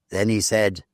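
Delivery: plain reading voice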